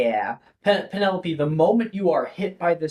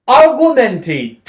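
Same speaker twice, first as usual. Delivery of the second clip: spell incantation; 8 semitones higher, 8 dB louder than usual. Acted. Casting spell aloud.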